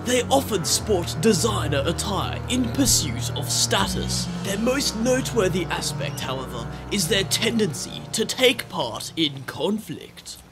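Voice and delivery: measured wildlife film narration; nature documentary narrator. Acted.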